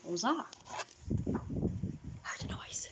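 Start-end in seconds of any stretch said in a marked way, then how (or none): 1.3-2.9 whisper. Real, not acted. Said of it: "unvoiced whisper".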